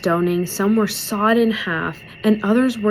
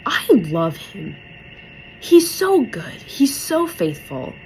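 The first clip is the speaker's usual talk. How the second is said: slow, drawn-out gushing; gushing adoration; slower than usual. Retold or imitated. Believer reacting to a story about God, gushing. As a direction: stretch it out, overcome with love. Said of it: "I'm like".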